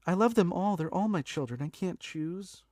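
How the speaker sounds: high-pitched, playful gushing; mock doting parent. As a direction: plead that you can't pick a favorite. Playing doting parent of characters.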